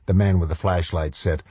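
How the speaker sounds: usual speaking voice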